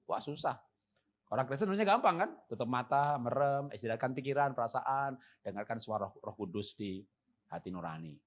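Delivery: usual speaking voice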